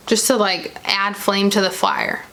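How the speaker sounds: ordinary talk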